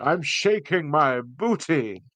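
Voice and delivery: mock shout. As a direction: belt it out like a silly party cheer. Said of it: "I could just shout".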